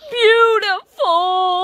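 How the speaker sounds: slow, choked-up beautiful; tearful, moved. Acted. Fish moved to tears by the kid's book, choking up. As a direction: overcome with emotion, drawn out.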